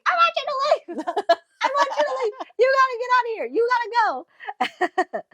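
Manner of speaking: high squealed mock scream, laughing; Astoria screaming. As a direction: shriek it in a very high hammed-up voice. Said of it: "Astoria just starts screaming".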